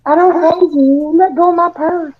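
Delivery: put-on very high, shrill old-lady screech; shrieking old lady. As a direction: squeal it like a startled granny.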